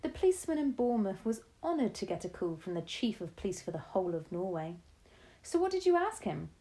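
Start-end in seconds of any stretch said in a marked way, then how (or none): 5.4-6.5 put-on raised, eager boy's question; curious grandson asking; young boy voice.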